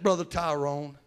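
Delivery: normal talking voice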